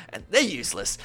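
usual speaking voice

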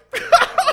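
high squealing laugh bursting out; sudden high shriek of laughter. Real, not acted. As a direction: lose it completely, laughing high. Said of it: "I just lose it".

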